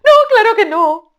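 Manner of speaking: a gleeful tailorbird crowing praise; sing-song high loud shout; shouting bird. Acted. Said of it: "dialogue reply".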